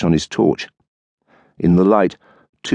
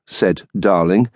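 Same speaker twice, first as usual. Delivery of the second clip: usual speaking voice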